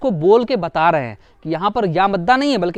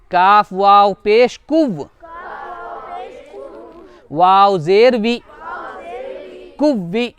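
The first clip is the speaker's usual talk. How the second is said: spelling drill; slower than usual. Shown as a demonstration. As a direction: chant each letter slowly for the class. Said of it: "करके यहाँ पर बताते हैं".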